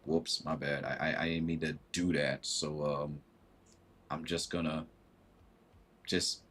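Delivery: hurt Virgo quietly backing down; sheepish apology. Retold or imitated. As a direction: shrink away after being told off. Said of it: "they're thinking".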